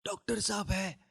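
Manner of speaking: very soft croaky ask; hoarse whisper. Retold or imitated. Man with a lost voice asks the doctor's wife at the door, barely audible. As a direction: rasp it out with no voice. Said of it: "मेरी आवाज़ बैठ गई".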